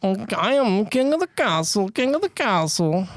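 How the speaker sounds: swooping Borat impression. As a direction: boast in a comic foreign voice. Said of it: "What's another Borat one?".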